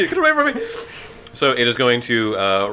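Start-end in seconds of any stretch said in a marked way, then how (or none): 0.1-0.7 high panicked squeal; shrill panic. Pretend. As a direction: shriek it in mock terror. GM voices panicked reaction.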